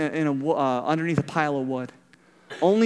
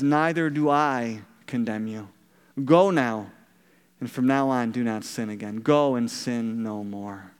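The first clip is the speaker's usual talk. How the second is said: gentle and lower; 7 semitones lower than usual. Retold or imitated. Jesus forgiving the woman.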